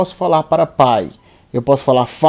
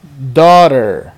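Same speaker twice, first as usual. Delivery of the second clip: ordinary talk, nothing marked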